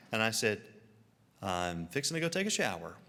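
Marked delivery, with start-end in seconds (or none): 1.4-2.9 lower, unhurried restatement; matter-of-fact repeat. Retold or imitated. Traveler repeating himself to baffled Canadians, low and matter-of-fact. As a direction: repeat it evenly, dropping the pitch.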